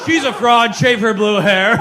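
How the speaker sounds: loud, high accusing shout; hammed-up public condemnation; mock denunciation. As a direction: cry it out like a heckling accuser. Acted denunciation of the fake queer woman.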